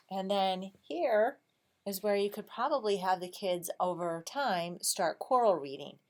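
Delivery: ordinary delivery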